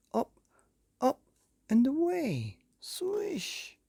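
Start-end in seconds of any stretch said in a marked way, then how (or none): 2.8-3.8 swish. Acted. Floating away sound.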